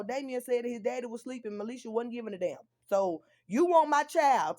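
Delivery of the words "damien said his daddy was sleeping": ordinary talk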